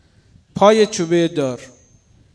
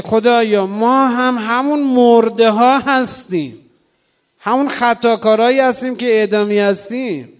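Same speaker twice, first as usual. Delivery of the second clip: raised, higher confessing voice; fervent confession; 4 semitones higher, 5 dB louder than usual. Acted. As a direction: cry out as a sinner. Preacher's fervent confession to God.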